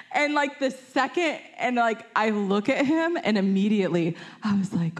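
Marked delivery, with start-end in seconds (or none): 0.1-0.3 sharp unvoiced gasp, pitched high; shocked gasp. Gasp as her friend sees the raspberry at the teacher. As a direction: gasp like a classmate watching in disbelief. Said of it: "her face goes".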